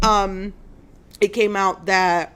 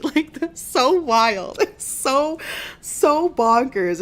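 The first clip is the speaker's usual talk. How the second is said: giggling, higher and slow; can't stop laughing; 6 semitones higher, slower than usual. Real, not acted. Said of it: "Sorry, it's so funny".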